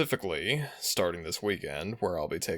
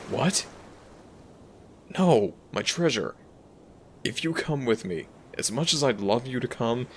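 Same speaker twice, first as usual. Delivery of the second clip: louder, urgent plea to a treasured human; protective dragon, worried; 2 semitones higher, 4 dB louder than usual. Acted. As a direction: play a concerned dragon shielding their companion. Dragon character worries over human companion.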